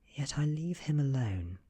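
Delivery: ordinary delivery, nothing marked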